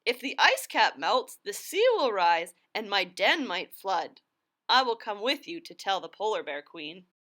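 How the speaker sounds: a small animal fearing its den floods; lightly voiced critter; worried critter. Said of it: "snuffled Harry Clary".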